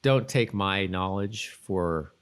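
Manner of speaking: usual speaking voice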